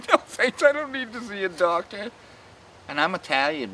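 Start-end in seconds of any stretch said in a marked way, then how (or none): 0.1-2.1 high, louder lady's voice; mock lady. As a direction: squeak it out like the lady. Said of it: "And she goes".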